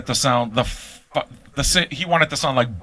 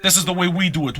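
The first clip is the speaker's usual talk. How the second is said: usual speaking voice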